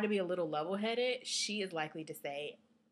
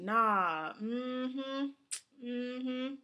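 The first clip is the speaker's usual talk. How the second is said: lightly acted knowing hum, higher; insistent disbelief; 6 semitones higher, much slower than usual. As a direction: hum it like you're not buying it. Sandwich worker insists he knows her.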